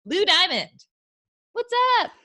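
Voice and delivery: loud, high announcer hype; game show host. Acted. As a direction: call him onstage like a host. Announcing the guest's entrance.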